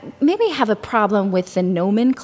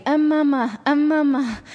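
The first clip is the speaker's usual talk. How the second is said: put-on higher, babyish mama calls; baby calling mama; 5 semitones higher than usual. Baby in crib calling to mother, higher babyish voice. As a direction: call out like a baby stuck in a crib. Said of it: "he kept saying".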